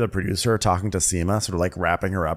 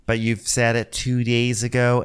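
ordinary talk, nothing marked

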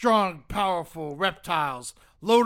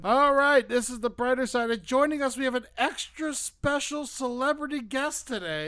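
host announcement; 5 semitones higher than usual. Acted. Announces guest again, bigger.

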